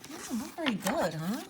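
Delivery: usual speaking voice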